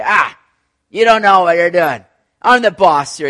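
stubborn man shaking his fist at God, loud; defiant rebel. Retold or imitated. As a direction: shout up at heaven like the boss.

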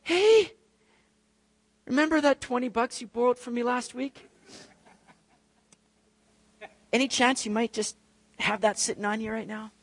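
high, breezy, oblivious request for money; tactless casual ask. Retold or imitated. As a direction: ask about the twenty bucks as if nothing happened. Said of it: "you go".

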